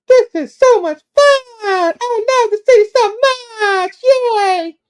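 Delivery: very high, drawn-out tipsy squeal; hammed-up drunken lady falsetto; drunk weird lady. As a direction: squeal it slowly like a gushing drunk woman. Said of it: "she's kinda drunk".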